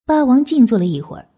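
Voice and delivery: ordinary delivery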